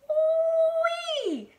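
very high, loud "whee"; long piercing squeal of delight; gleeful scream. A narrator's reading. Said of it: "she screamed".

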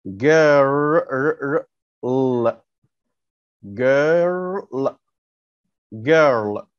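put-on "girl" drawn out, hard g clearly sounded; modelled word. Shown as a demonstration. Teacher sounds out "girl" for the class, slow and clear. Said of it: "ده صوت حرف ايه؟".

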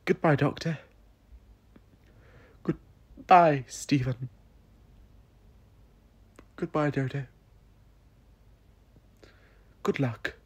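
high, tearful farewell; calling out goodbye through tears; holding back tears. Acted. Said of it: "I held back the tears".

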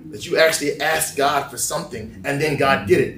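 normal talking voice

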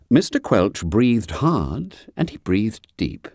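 ordinary delivery, nothing marked

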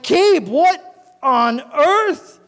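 high, loud, slow disbelief; appalled exclamation. Acted. Owner to his stinking dog, appalled. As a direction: space out each word, appalled.

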